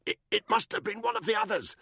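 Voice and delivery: high, choked stammer; strangled voice. A narrator's reading. Buckingham choked by Danny, strangled and high. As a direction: squeeze it out as if half-throttled. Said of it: "in a strangled voice".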